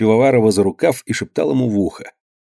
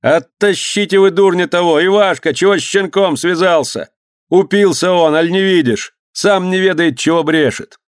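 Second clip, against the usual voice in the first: sustained high, raised voice; shouting over everyone; 7 semitones higher than usual. A narrator's reading. Pivovarov orders men to drag Usov away, shouting. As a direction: plead and order above the uproar. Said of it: "пытался перекричать всех Пивоваров".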